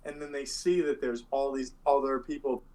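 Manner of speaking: ordinary delivery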